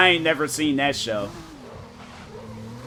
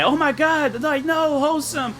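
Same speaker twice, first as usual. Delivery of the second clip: much higher, whiny dramatic wail; mock panicked friends; 13 semitones higher than usual. Friends fussing over sick Wholesome, hammed-up high distress. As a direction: ham up the group's distressed gasping.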